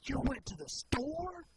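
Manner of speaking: an outraged high-pitched "you went to the store?"; indignant squeal of disbelief; accusing shocked question. Retold or imitated. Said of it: "and I say".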